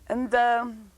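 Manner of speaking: usual speaking voice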